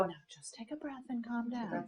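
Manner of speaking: soft, patronising calm-down line; mock calm-down. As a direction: hush them condescendingly. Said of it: "they go".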